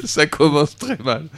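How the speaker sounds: amused, barely over a laugh; real laughter. Real, not acted. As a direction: drag the words out through the giggles. Cracking up as the show derails.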